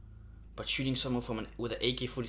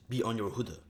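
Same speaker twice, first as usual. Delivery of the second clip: ordinary delivery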